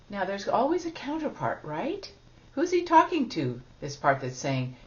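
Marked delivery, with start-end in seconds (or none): none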